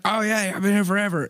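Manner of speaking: high, loose attempt at a New Jersey guy's accent; failed New Jersey accent. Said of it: "I can't do his accent".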